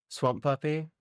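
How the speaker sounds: ordinary delivery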